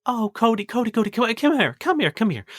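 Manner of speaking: high, coaxing comfort; soothing cook. Acted. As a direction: gather the crying child in. Isabella soothing crying Cody.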